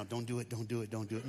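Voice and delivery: a soft inner voice pleading; quiet inner voice. Retold or imitated. The audience warning themselves under their breath, quietly. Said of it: "telling yourself right now".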